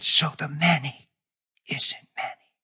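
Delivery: "So the many isn't many" breathed softly, voiceless on "isn't many"; whisper. Retold or imitated. Preacher voicing God's quiet revelation to him, whisper. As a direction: hush "isn't many" like a secret. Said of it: "He whispered".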